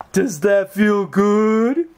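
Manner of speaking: high, slow cooing pet names; baby talk to pet. Acted. Owner cooing silly nicknames to Fergus. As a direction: dote on the dog with silly names.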